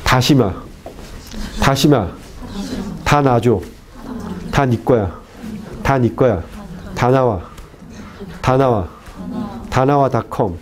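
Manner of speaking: Korean words modelling the t of "Tashima"; "Ta nawa" with a Korean plosive t. Shown as a demonstration. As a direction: stop the air on each initial "Ta". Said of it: "igual que ti del coreano".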